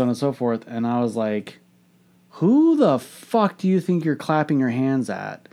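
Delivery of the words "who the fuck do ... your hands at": slow, high-pitched indignant challenge; retold snapping. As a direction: act out an irritated comeback, drawn out. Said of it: "snapping at her".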